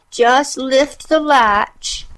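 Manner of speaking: put-on high, slow old granny voice; slow frail granny; old grandmother voice. A narrator's reading. Grandmother invites.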